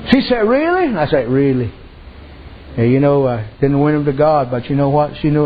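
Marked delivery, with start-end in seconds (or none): 0.5-0.9 high, hopeful surprised question; surprised lady. Retold or imitated. Lady hearing God will lift her burden, surprised. 1.3-1.7 low, calm confirming reply; calm assurance. Retold or imitated. Preacher reassuring the lady, calm.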